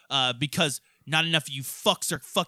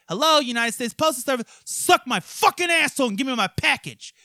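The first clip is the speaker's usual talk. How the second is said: mock phone yell; 8 semitones higher, 5 dB louder than usual. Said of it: "I fucking dialed her on my goddamn iPhone".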